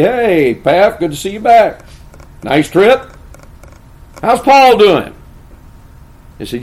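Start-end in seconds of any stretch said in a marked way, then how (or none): 0.0-5.1 loud, high, hammed-up hello; mock-breezy greeting, pitched up and loud; casual welcome-back greeting. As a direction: act out an over-hearty welcome. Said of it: "don't just say".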